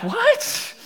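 tiny high-pitched rising question; mock bewilderment, soft and squeaky; confused. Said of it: "so I'm confused".

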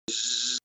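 soft, sustained buzz; imitated door buzzer. Rabbi mimics the prison door, soft buzz. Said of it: "you really hear it".

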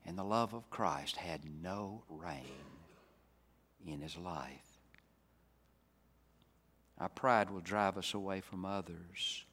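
normal talking voice

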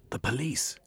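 breathless whispered alarm; startled whisper; gasped whisper. A narrator's reading. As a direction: hiss it out in shock. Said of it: "Jeremy turned round and gasped".

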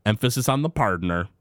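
put-on slow, drawling Southern cowboy accent; slow Southern drawl hamming up the partner bit; southern twang. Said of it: "We're gonna be using a little twang?".

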